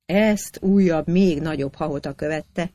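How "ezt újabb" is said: ordinary delivery, nothing marked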